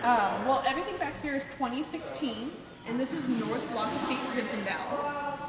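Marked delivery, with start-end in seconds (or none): none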